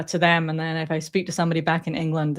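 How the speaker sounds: usual speaking voice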